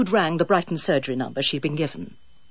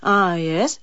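uninterested. Acted. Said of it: "without much interest".

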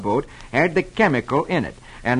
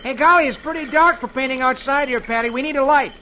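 high, louder call to a friend; pitched up, urgent holler for help; 10 semitones higher, 4 dB louder than usual. A narrator's reading. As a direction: call over to someone still indoors. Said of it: "He calls to Patty".

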